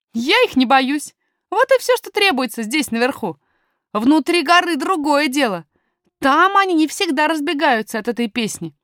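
put-on confident young miner talking to strangers; boy miner, self-assured.

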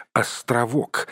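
ordinary delivery

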